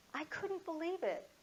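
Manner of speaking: high-pitched amazement at ordinary volume; softened disbelief. Real, not acted. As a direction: repeat the astonishment without shouting. Repeats her amazement, less loud.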